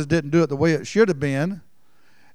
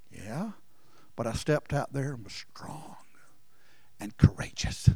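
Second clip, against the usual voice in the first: softer, near whisper; hushed; 4 semitones lower, 9 dB quieter than usual. Real, not acted. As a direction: confide it quietly. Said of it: "preacher drops to hushed testimony".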